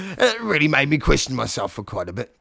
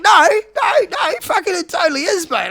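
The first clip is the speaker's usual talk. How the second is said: high, loud insisting; a hammed-up eager salesman; 8 dB louder than usual. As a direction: talk the dragon into it.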